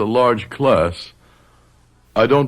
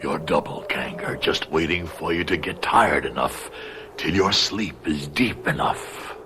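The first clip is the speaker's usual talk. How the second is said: a patient ghost wearing down its prey; eerie menace; 4 semitones lower, 7 dB quieter than usual. Acted. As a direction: lean in and breathe the threat. The double menaces him eerily.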